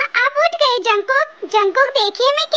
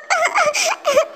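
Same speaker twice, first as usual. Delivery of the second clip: sob; 7 semitones higher than usual. Acted. Wife alone at night, sobbing. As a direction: let the crying fade into a sob.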